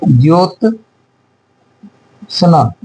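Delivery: Jyotsna slowly, long pause between "jyot" and "sna"; broken-up dictation. Shown as a demonstration. Dictating, word broken into parts.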